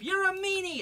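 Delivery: put-on high, loud childish yell; high, loud cry of a sore loser. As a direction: shout it up from the jam. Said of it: "yelled up".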